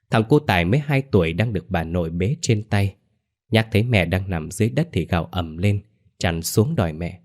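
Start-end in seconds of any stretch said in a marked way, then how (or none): none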